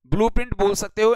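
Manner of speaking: normal talking voice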